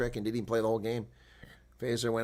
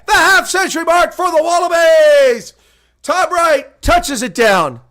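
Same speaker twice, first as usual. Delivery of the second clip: commentator call building from quick and brisk to a much higher, louder shouting peak; play-by-play call; 11 semitones higher, 16 dB louder than usual. Acted. Calling the winning try live for his viewers, rising to a shout. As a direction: call the breakaway try like a live broadcaster, climbing to a roar.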